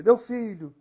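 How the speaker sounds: high shrill cry of a panicked mother; mother's shriek. Retold or imitated. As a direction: shriek it like a frightened mom. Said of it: "leva a mão na cabeça e grita".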